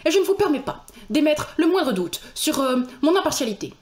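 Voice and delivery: a hint of an indignant clerk judge; Stangerson's voice. Streamer voicing the clerk for chat, mildly indignant. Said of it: "Il parle un peu comme ça".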